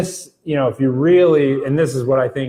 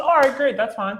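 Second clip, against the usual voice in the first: much higher, bright "All right, great, that's fine."; genuinely fine; 10 semitones higher than usual. Retold or imitated. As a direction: pitch it up and sound truly okay with it. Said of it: "by the way she says it's fine".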